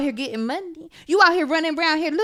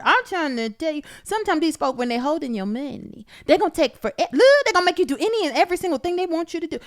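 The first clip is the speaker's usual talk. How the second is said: ordinary talk, nothing marked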